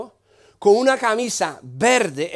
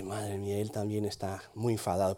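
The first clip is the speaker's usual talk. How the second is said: murmullo grave para sí; pensamiento en voz baja; 12 semitones lower, 14 dB quieter than usual. Retold or imitated. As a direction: decirlo muy bajo y grave, como pensando. Pensamiento propio contado por lo bajo. (pensamiento murmurado en voz baja)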